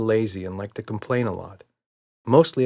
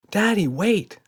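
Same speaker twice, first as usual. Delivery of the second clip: put-on much higher child's call; 11 semitones higher, 4 dB louder, slower than usual. A narrator's reading. Emma calls Daddy back from the door, childish call. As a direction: call out like a little girl. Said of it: "called Emma".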